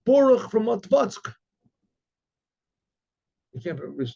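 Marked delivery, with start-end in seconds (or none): 0.1-1.3 calling out a name. Retold or imitated. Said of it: "yells out his name".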